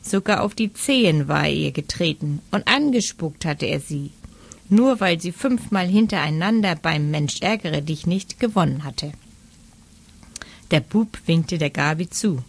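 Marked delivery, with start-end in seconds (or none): none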